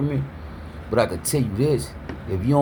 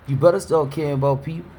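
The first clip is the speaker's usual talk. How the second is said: usual speaking voice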